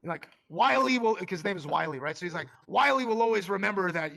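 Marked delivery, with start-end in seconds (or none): 0.5-1.2 loud, high self-important claim; mock proud boast. Narrator imagining the founder remembering him, loud proud boast. 2.7-4.2 loud, high self-important claim; mock proud boast. Narrator imagining the founder remembering him, loud proud boast.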